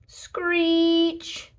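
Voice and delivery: high screeching toaster noise. A narrator's reading. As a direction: screech like metal scraping.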